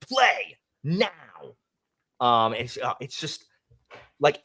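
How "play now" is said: mock yell. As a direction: retell the yelled order with raised pitch. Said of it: "And so he yells at him".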